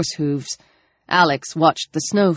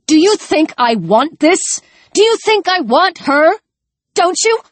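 furious, much higher and louder; spat out; 11 semitones higher, 3 dB louder than usual. Acted. Alex spitting furious words at Chris before slapping him. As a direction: spit every word at him. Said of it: "she spat".

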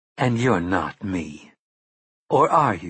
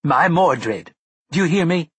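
louder, higher cry of crazed pride; mad pride; 7 semitones higher, 5 dB louder than usual. A narrator's reading. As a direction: proclaim her son like a zealot. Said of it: "pride that sounded alarmingly close to insanity".

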